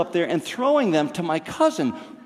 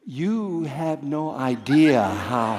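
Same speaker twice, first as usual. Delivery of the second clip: slow, wry; 2 semitones lower, much slower than usual. Retold or imitated. Dad's slow wry reply.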